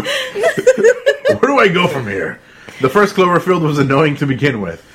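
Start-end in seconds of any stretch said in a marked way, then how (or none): 0.0-2.4 high, slow, laughing. Shown as a demonstration. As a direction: squeak it out through laughter. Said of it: "say the title all over again".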